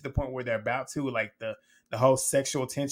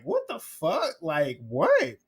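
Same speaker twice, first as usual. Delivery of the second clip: high, shocked what the fuck; stunned disbelief; 4 semitones higher, much slower than usual. Retold or imitated. Liv and Nicole hearing Kendall on the video, stunned. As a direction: jump way up in pitch, floored. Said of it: "Liv and Nicole was like".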